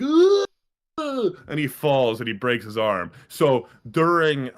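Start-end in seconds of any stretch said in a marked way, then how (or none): 0.0-0.5 a sudden high cry of pain; high loud yelp. Retold or imitated. The boy falling and breaking his arm, a high yelp. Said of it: "he's like".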